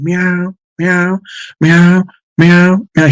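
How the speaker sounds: high, whiny imitated meowing; imitating cat meows. Retelling the cat's fall, imitating her high meows. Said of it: "she's going".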